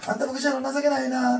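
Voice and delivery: playful Osaka mother chiding; Osaka dialect. Retold or imitated. Mother laughing at her pastor son, loud high Osaka-ben. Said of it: "って大阪弁ですね".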